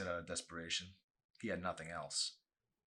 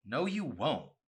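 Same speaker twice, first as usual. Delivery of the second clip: curt, louder angry comeback; angry quip; 6 semitones higher, 7 dB louder, slower than usual. A narrator's reading. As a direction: shut her down with a sharp retort. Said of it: "Chelsea quipped angrily".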